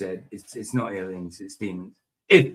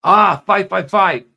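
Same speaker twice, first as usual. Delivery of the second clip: mock exclaim; 6 semitones higher, 11 dB louder, slower than usual. Mock reaction to the number.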